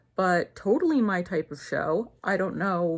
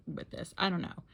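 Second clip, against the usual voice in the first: normal talking voice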